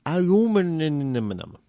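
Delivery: slow, mushed kid attempt at "Aluminum"; little kid mispronouncing. Host imitating a small child stumbling over the word, slow and mushy. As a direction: mangle "Aluminum" slowly like a four-year-old. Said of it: "little kid try to say the word aluminum".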